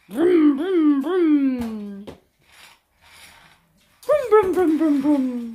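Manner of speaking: loud playful engine vroom; car vroom noise. Acted. Mother playing cars with the boy, playful vroom. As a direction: make the toy truck go.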